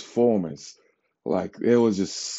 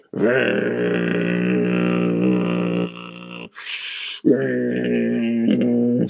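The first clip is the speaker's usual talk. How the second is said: drawn-out laugh; long wheezy laugh; 9 semitones lower than usual. Real, not acted.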